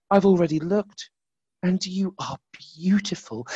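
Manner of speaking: high, soft tender voice. Acted. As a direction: reassure it gently, high and soft. Light tenderly reassures darkness.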